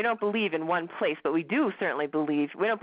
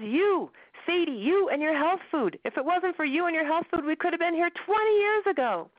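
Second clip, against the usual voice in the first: exasperated husband; 10 semitones higher, 3 dB louder than usual. Acted. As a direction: lay the blame on her word by word. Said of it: "Max gets angrier and angrier".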